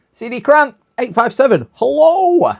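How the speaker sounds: high, loud bright hello to chat; excited greeting. Real, not acted. Streamer greets a viewer arriving in chat, high and loud. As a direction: sing out the hello to a new viewer.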